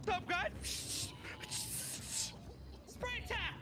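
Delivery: high-pitched battle cry; shouting. Pretend. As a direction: call the attack out high and sharp. Game fight starts.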